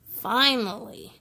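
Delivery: lively picture-book read-aloud with voiced characters; reading to children. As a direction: bring each rainy-day page to life for little listeners.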